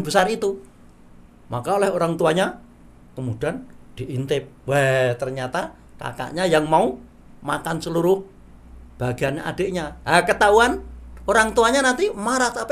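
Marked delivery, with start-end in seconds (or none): none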